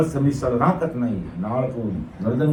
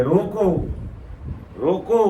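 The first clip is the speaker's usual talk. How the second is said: short high calls to halt; calling out; 5 semitones higher than usual. Acted. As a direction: call it out as if halting someone. Acts gods stopping departing souls. (ऊँचे सुर में छोटी पुकार)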